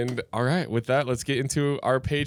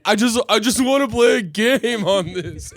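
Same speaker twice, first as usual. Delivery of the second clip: whiny customer; 7 semitones higher, 5 dB louder than usual. Retold or imitated. Guy retold again at jukebox.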